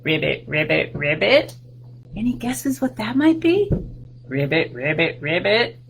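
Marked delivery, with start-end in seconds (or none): 0.0-1.5 lower, croaky ribbit; frog croak. Shown as a demonstration. Frog sound in song. 4.3-5.8 lower, croaky ribbit; frog croak. Shown as a demonstration. Frog sound repeated.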